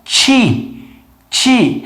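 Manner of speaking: ti said with the middle of the tongue, like chee; English ti sound. Shown as a demonstration. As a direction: show the ti with the mid-tongue. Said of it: "A gente faz isso aqui, ó".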